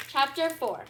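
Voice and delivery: ordinary delivery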